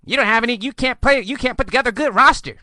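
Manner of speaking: high, fast fussing; mock complainer. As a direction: rattle off the objection in a mocking high voice. Quotes the week seventeen haters.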